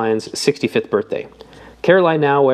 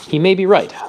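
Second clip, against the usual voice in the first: higher, louder, testy; irritable; 8 semitones higher, 4 dB louder than usual. Acted. Obispo impatient with Jeremy's reading, irritable. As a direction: brush it off with irritation. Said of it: "said Doctor Obispo irritably".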